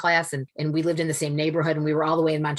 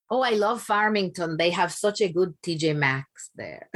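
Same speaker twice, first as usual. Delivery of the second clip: Dominican mother impression; 3 semitones higher, slower than usual. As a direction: gush like her Dominican mom about shopping. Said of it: "She would say".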